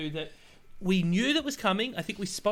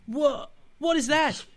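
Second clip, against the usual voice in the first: outraged what; 8 semitones higher, 4 dB louder, slower than usual. Real, not acted. Outrage at marked-up deck prices.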